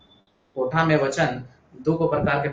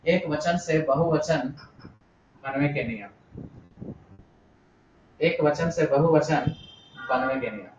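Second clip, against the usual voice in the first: slow dictation, dropping softer at each phrase end; dictating; 2 semitones lower, 3 dB quieter, slower than usual. Shown as a demonstration. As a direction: dictate slowly in separated chunks. Dictating a heading to students.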